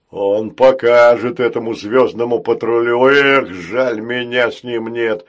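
loud, booming voice; a hint of regret; rueful giant robot. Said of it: "огорчился рогатый робот". (огорчённо, громко)

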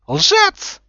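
very high, loud shriek; screamed accusation. A narrator's reading. Umbridge flinging Harry aside, screaming. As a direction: scream it in outrage. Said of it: "завопила Амбридж".

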